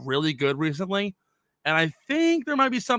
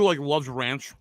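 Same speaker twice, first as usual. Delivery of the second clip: ordinary delivery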